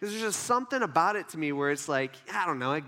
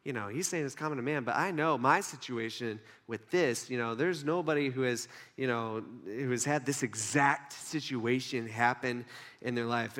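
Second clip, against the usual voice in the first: low, softer musing; skeptical thinker; 7 semitones lower, 4 dB quieter than usual. Acted. Critical listener reasoning with himself, low and musing. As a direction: voice a doubtful thinker's private reasoning. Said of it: "you're like thinkers".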